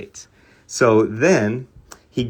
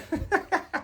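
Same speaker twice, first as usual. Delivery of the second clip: soft high laugh escaping; high giggle; 8 dB quieter than usual. Real, not acted. Telling viewers the story, he giggles helplessly. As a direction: giggle you can't hold in. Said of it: "I can't even say it without laughing".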